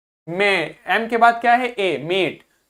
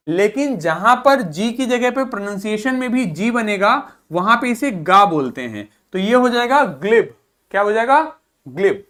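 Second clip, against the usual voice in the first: ordinary talk, nothing marked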